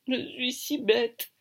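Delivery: acted crying, high and slow; high-pitched tearful self-reproach. A narrator's reading. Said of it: "La gamine pleure de plus belle".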